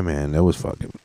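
ordinary talk, nothing marked

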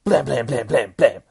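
loud mock gunfire; gunshot sounds. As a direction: blast out each shot. Narrating gunshots.

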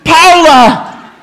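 high, loud, slow royal proclamation; solemn regal knighting. Pretend. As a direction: knight her with grand ceremony. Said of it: "Who wants to knight Pa".

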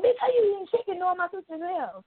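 mouthing off at the nail tech eating chicken; sassy callout. Retold or imitated. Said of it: "Like, talking plenty shit".

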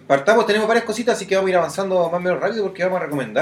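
rushed fast without a pause; all in one breath. Real, not acted. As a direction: race through it without breathing. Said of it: "Ya, pero respira".

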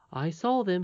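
a hint of a cheerful young miner; confident miner boy. Miner boy reassuring those he met, lightly voiced.

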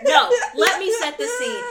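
loud, high, laughing eagerness; excited laughing. Real, not acted. Cutting in to tell cohosts the story, loud and laughing. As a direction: jump in to take over the story.

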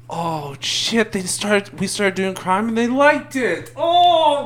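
high mock-panicked wail; horrified regret. Itachi realises his team liked doing crime, horrified.

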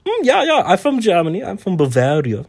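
put-on high, bouncy German accent; a Bavarian trainer answering proudly; German accent, high and lively. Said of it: "is your accent German?".

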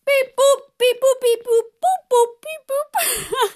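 high-pitched robot beeping and booping; playful robot beeps. Pretend. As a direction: beep like a toy robot on the breath out. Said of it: "go beep, boop, beep every time we breathe out".

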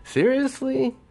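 drawn-out, rising, high-pitched disbelief; incredulous. Acted. Indignant rhetorical questions to absent suitor.

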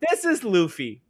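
bright-pitched, loud narrator introducing themself; high, sing-out mock narration; Yamato. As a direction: greet the audience like a peppy storyteller. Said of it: "put Yamato's voice in there".

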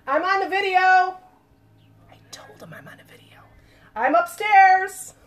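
loud, high yell to another room; yelling across the room. Real, not acted. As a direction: shout it upstairs to someone. Calling out to someone elsewhere.

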